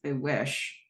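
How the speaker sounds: softly imitated under-the-breath remark; under her breath. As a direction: mutter it softly to yourself. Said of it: "under her breath, she says".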